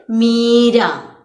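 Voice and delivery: मीरा said slowly and loud; slow stressed मीरा. Shown as a demonstration. Teacher modelling मीरा slowly for children. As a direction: stretch out मीरा for the class. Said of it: "example मीरा".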